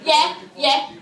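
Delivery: put-on chav accent, pitched slightly up; imitated chav English; British chav. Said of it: "a British chav would sound politer".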